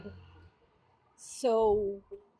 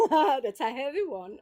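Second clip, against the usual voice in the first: laughing through the words; 6 semitones higher than usual. Real, not acted. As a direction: laugh it out, higher. Laughing at accent remark.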